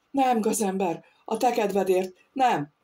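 ordinary delivery

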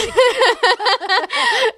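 long high giggle; high laugh. Real, not acted. Laughs after friend's comment.

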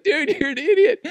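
high, laughing put-down; laughing jab. Retold or imitated. Daughters laughing at their dad, high laughing jab. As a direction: tease him through laughter. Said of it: "leave me like".

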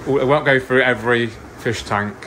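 usual speaking voice

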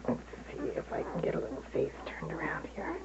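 soft whisper beside sleeping puppies. Real, not acted. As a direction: breathe the words, no voice.

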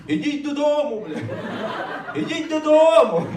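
high, yelled go home; mock shout. Comedians imagine yelling at invaders, high mock shout. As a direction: scream it at the enemy, half-laughing.

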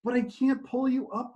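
high, desperate cry. Acted. Man crying out to the dangling stranger, high desperate plea. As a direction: plead that you can't hold him. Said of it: "the man cried".

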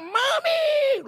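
high shouted cry for mom; child yelling for his mother; child's yell. Acted. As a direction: scream for mommy from the gutter. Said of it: "dia teriak".

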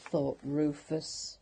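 ordinary delivery, nothing marked

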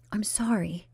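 soft unvoiced sorry; whispered apology. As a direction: breathe out the apology. Bernice contrite.

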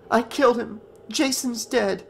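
high, upset confession; voice breaking. A narrator's reading. Tearful Tina confesses to Nick, voice breaking. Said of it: "her voice breaking".

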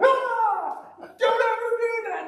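high shriek with sobbing laughter, very loud; retold terrified scream. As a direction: scream like a scared kid caught off guard. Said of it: "I screamed".

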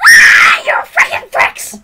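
put-on baby voice. Showing his friend his baby voice, a slow whispered mock baby shriek. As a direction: squeal it like a cranky toddler. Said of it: "Do you wanna hear my baby voice?".

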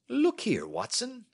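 a confident detective holding forth; Holmes voice. Acted.

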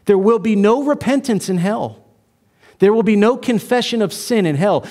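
normal talking voice

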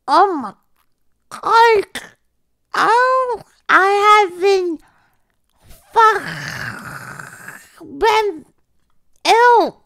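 loud, strained, slow and choking; gagging distress. Real, not acted. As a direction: force it out between retches. Gagging on anchovy banana bite.

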